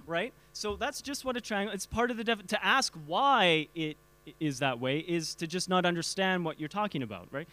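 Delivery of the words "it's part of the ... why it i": ordinary talk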